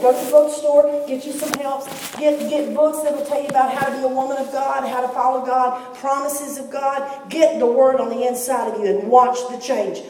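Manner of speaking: full-force pulpit exhortation; fiery preaching. Acted. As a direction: drive it home to the congregation.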